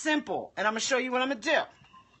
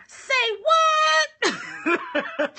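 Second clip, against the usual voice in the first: loud, laughing "what?" that rises; a startled laughing shout; surprised say what; 14 semitones higher, 6 dB louder than usual. Real, not acted. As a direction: shout it back in disbelief.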